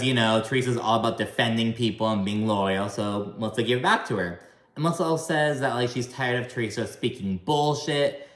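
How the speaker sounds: ordinary delivery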